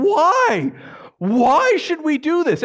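high, loud, drawn-out cry of protest; acted screaming. First century audience hearing the command, screamed protest. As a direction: scream the question as a baffled crowd. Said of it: "audience there is screaming".

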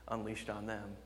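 ordinary talk, nothing marked

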